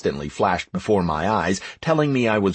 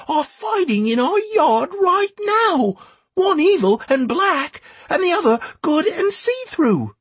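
put-on very high-pitched child's voice; breathless-to-tell-it little boy, pitched right up; excited lad. A narrator's reading. Boy telling Mayan about his dog, excited child voice. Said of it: "Beth dragged the excited lad away from me".

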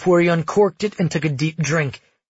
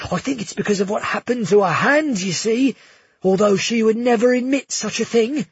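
gushing innkeeper; 6 semitones higher than usual. Acted. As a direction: fawn over the princes in a high, thrilled voice. Bruno gossiping about Valia.

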